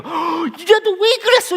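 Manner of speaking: gasp, then very high, loud reproach; shrill-pitched parent outburst after a scare; exasperated mother. Acted. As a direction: gasp and burst out at the child. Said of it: "이런 거예요".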